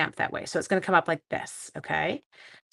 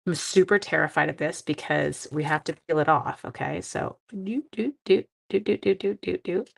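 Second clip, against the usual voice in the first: talking with tongue sticking out. Real, not acted. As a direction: speak around a poked-out tongue. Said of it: "My tongue is sticking out so badly right now".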